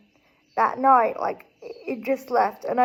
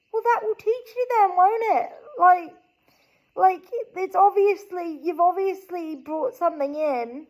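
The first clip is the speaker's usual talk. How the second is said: higher-pitched mock parental telling-off; imitated scolding dad; 6 semitones higher than usual. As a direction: imitate Dad saying it serves you right. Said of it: "And he's like".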